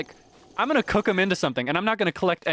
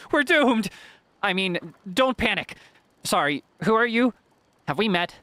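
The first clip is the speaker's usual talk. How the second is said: more despair; 5 semitones higher than usual. Shown as a demonstration. Redoing the NPC line with more despair, high cry. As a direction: wail the doom, then backpedal awkwardly. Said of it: "gotta have sound more despair".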